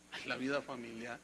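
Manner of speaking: low, soft groaning sigh at the topic; mock congregant sigh. Mocking a reluctant churchgoer, a low soft groan.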